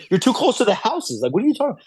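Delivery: high-pitched retold disbelief; incredulous protest. Falconer arguing with the poacher, incredulous. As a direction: argue back as if the claim is absurd.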